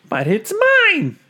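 high screechy bird outburst; screamed. Acted. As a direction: shriek to grab it back. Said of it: "screamed the bird".

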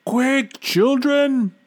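high, loud urgent call; shouting mother bear. A narrator's reading. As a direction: call the cubs out in a hurry. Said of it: "the bear mother shouted".

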